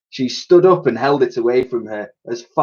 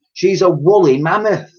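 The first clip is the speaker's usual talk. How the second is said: haughty; 8 semitones higher, 5 dB louder than usual. A narrator's reading. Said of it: "replied El-, Elsie haughtily".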